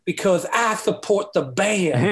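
slow lisping rock-star stage voice; drawn out with a stage lisp. Retold or imitated. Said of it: "in his classic stage lisp".

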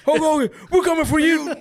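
high, loud promo shout; wrestling promo. Acted. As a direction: call out Hogan like a wrestler.

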